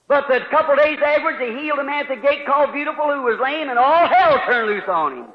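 usual speaking voice

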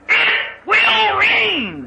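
very high, drawn-out shout; thundering conviction. Acted. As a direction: proclaim it slowly at full voice. Noah firmly answers doubting son.

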